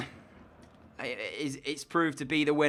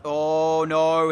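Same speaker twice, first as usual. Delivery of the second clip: ordinary talk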